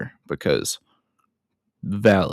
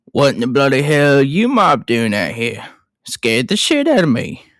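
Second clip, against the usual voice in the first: hammed-up Australian accent, rising higher; Mick Taylor; 3 semitones higher than usual. Retaking the villain's first line after messing it up, Australian accent. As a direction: put on a broad Australian accent. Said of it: "Mick Taylor finally says his first line".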